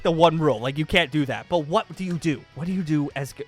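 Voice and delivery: ordinary talk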